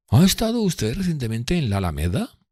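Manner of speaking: voicing Drumley prying; Drumley's voice. A narrator's reading. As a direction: needle him. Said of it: "preguntó Drumley".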